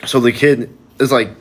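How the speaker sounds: ordinary delivery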